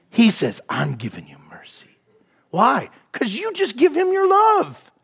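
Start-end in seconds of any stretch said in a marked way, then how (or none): 0.7-2.0 tender, lower, whispered mercy. Acted. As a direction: give it softly, no voice. God voiced, whispered.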